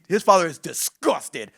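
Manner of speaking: "disgusted" drawn out, slow and punched; slow emphatic weight on "disgusted"; stress on disgusted. Real, not acted. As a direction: hit "disgusted" hard, stretching it slowly. Stresses the father's disgust in retelling.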